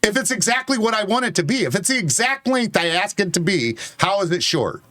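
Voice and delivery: real heated insistence; being loud. Real, not acted. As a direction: argue it loudly, leaning on each point. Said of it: "Because I'm being loud".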